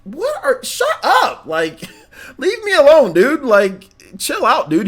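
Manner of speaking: high, mocking exasperation; squeaky, laughing brush-off of complainers; annoyed retort. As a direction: tell the whiny commenters to chill out. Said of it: "I just muted all of them and just said".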